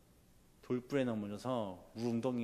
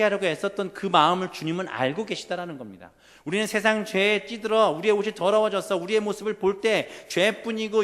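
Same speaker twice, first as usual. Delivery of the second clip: raised, louder pulpit proclamation; impassioned preaching; 7 semitones higher, 8 dB louder than usual. Acted. Preacher tells congregation God knows their struggle, impassioned preaching. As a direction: press each phrase home to the congregation.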